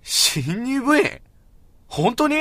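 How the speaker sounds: loud, high, drawn-out disbelief; stunned disbelief. Acted. Boy reacting loudly to news of a new club member, stunned disbelief. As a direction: blurt it out, unable to believe it. Shocked a girl joined club.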